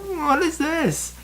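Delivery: high, slow nervous squeak. Acted. Obi-Wan eyes the huge bag, nervous and high. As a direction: squeak it out, scared. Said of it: "he commented nervously".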